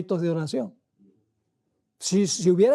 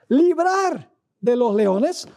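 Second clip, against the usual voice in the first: "librar" slow and lifted higher; stress on librar; 4 semitones higher, much slower than usual. Shown as a demonstration. Preacher pointing out the key word to the congregation, stressing "librar". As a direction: draw out "librar de los leones" slowly. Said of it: "aquí está la palabra clave".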